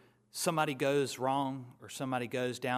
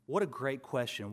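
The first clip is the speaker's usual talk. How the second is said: ordinary talk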